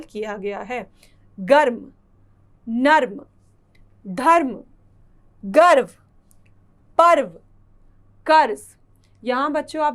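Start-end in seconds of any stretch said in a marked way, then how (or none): 1.4-8.6 each word said alone, louder, with long pauses; reading word list. Shown as a demonstration. Teacher reads a word list to children, loud and spaced. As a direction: read out गर्म, नर्म, धर्म, गर्व, पर्व, कर्ज one by one. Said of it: "आइए कुछ और शब्द पढ़ते हैं".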